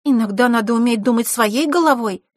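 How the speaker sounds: high, firmer scolding; reproach. Acted. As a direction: tell her off.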